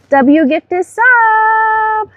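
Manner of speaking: very high, slow silly voice; high drawn-out hype voice. Pretend. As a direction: stretch it out in a goofy high voice. Streamer hype call.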